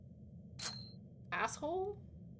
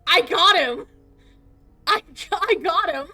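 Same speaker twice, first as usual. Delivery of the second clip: triumphant yell, much louder; excited shout; 7 semitones higher, 12 dB louder than usual. Real, not acted. Excited shout after catching.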